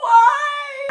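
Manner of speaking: high, loud wailed cry; hammed-up sob, drawn into a wail; mock bawling. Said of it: "started bawling, crying".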